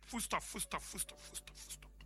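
fading to a whisper. Acted. Sarah answering God, fading to a whisper. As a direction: let it die away to a whisper.

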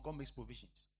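ordinary talk

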